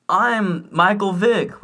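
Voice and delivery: high, drawn-out mock yell; slow playground holler, not full volume; retold kid's yell. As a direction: imitate a kid proudly calling out. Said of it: "I would yell".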